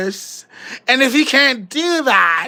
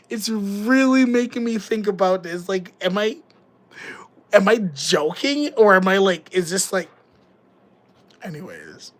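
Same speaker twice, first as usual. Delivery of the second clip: ordinary delivery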